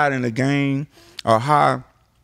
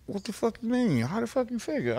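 higher, softer disbelieving question; incredulous; 7 semitones higher, 8 dB quieter than usual. Retold or imitated. Zero doubting Al's praise, incredulous. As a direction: push back in disbelief. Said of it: "He say".

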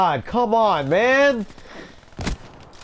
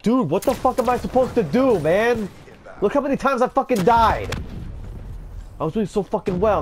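rising panicked rage, higher and higher; raging; 8 semitones higher, faster than usual. Real, not acted. Gamer panics as enemies close in, raging higher. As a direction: spiral from panic into a furious rant. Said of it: "I am so sorry for raging".

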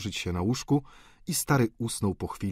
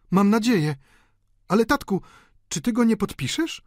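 very high female voice; high, loud opening into a pleading question; coaxing daughter; 13 semitones higher, 6 dB louder than usual. A narrator's reading. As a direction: wheedle father for a favour. Countess coaxes father to sign.

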